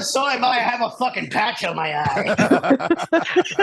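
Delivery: slow, drawn-out pirate voice; mock pirate growl; pirate driver. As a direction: ham it up as a one-eyed pirate. Pirate with an eye patch.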